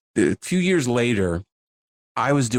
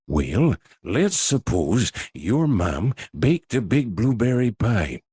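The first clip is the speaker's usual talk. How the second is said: Reagan impression; slower than usual. Recalling his old impressions for the hosts, slow Reagan voice. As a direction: ease into a folksy Reagan read. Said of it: "his voice was like".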